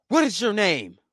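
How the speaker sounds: mock yelling. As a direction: imitate an angry customer yelling. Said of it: "she was yelling like that".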